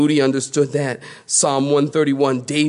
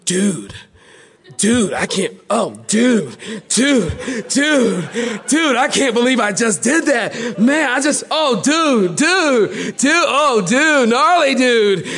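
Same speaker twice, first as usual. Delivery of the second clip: California surfer dude; 7 semitones higher, slower than usual. Retold or imitated. Embarrassed dad after wrong room, high surfer-dude babble. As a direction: gush embarrassed surfer slang. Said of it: "he goes".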